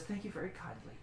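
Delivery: a near-whispered thank you very kindly; the fey woman softly retakes her thanks. Acted. Fey woman thanks the lockpicker, softly and hushed. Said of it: "That was me coughing, not her".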